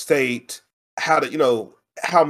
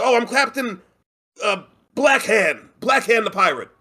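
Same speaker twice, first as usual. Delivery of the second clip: improvising pirate; 7 semitones higher than usual. Retold or imitated. Teacher making up a pirate name for kids, improvised announcement. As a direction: stretch the name out as you invent it. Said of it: "So, like".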